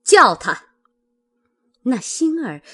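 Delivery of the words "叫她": very loud, abrupt order; stern sharp command. A narrator's reading. Mistress summons the frightened servant, harsh loud command. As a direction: fire it out severely, at full volume. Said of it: "凤姐儿厉声道".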